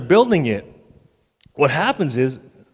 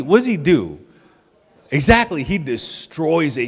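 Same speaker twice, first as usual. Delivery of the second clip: usual speaking voice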